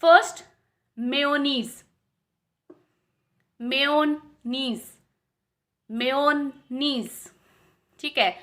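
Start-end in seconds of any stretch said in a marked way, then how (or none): 1.0-7.1 मेयोनीज said slowly as "meoneez"; correct pronunciation. Shown as a demonstration. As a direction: stretch out मेयोनीज, long n. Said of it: "इसका main pronunciation होता है". (मेयोनीज का सही उच्चारण, धीरे-धीरे)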